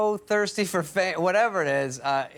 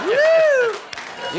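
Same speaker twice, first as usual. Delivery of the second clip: loud, very high falsetto "woo"; mock excited whoop; 8 dB louder than usual. Bachelor reacting to the chick's vase line, imitated excited whoop. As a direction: whoop like a delighted party guy.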